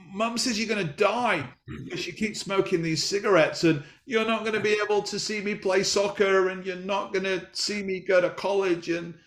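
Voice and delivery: lightly voiced upset little son; tearful child. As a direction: son tearfully repeats what his mum told him. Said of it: "He's crying his eyes out".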